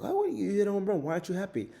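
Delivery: high, loud puzzled question; puzzled kids. Retold or imitated. Teammates asking Smalls why he's sad, high and puzzled. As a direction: ask him why he's not happy.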